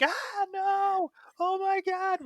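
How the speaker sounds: very high, mock-hysterical shriek; hysterical scream. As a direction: retell the dream panic in a shriek. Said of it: "screaming hysterically in the dream".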